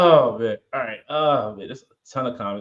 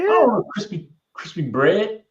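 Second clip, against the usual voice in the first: mock whiny kid voice; whiny complaint; 6 semitones higher, 4 dB louder than usual. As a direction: complain like a picky child. Acting own whiny refusal.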